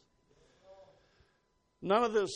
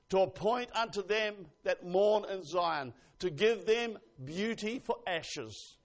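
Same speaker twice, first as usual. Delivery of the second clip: slow, emphatic declamation; scripture reading; 3 semitones higher, 5 dB louder, slower than usual. Real, not acted. Preacher reading Isaiah to the congregation, declaimed. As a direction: proclaim each phrase with weight.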